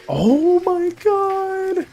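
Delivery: high, very drawn-out gasp of delight; awed excitement. Real, not acted. Streamer first seeing a viewer's artwork, awed high squeal. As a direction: stretch it out high, overwhelmed. Reacting to fan artwork just received.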